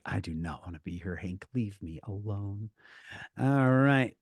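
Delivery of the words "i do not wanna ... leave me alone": low, quiet, grudging mumble; imitating someone who wants to be left alone, low and soft; imitated reluctant guest. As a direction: voice her reluctance under your breath. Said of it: "She's like".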